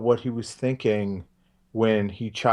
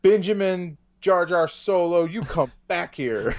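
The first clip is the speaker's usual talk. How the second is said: mock stern parent, pitched up; scolding parent; 8 semitones higher than usual. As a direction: call the naughty child by full name. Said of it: "Like he's in trouble".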